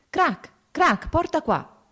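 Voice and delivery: high, loud shout to a dog; sharp jump up in pitch and volume; calling out. A narrator's reading. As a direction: call out the shots and order, pitched high. Hunter heard from afar, calling his dog.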